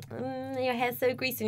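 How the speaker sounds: high snide schoolgirl voice; mocking schoolgirls. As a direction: sneer it like a mean classmate. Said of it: "everyone's like".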